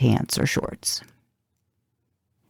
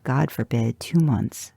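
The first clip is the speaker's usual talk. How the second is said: usual speaking voice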